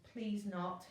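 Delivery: plain reading voice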